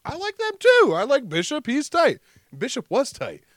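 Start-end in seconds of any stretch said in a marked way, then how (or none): 0.0-2.2 high-pitched, excited fanboy outburst; much higher, gushing imitation of a kid; eager kid quote. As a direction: act out a kid eagerly fitting in. Acting himself as an eager kid.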